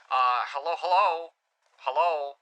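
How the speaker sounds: thin, band-filtered radio voice; walkie-talkie sound. Shown as a demonstration. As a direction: talk as if through a walkie-talkie. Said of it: "That's how you make, uh, a walkie-talkie sound".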